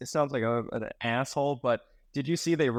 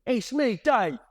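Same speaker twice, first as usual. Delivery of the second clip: much higher, hammed-up Cockney voice; mock Cockney accent; 10 semitones higher than usual. To co-host, mocking Dave with hammed-up Cockney.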